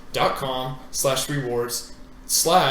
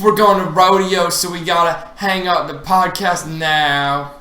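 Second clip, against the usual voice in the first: loud, high Texas twang; Carrie impression; 4 semitones higher, 7 dB louder, slower than usual. [name] doing Carrie, loud Texan drawl signing off. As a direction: boom out a Texan y'all like Carrie heading to the rodeo. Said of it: "That kinda sounds like Carrie from West Village".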